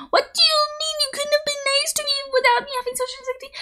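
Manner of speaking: high squeaky whisper of outrage; outraged disbelief. Real, not acted. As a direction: hiss it in shocked offense. Outraged reply to the clerk.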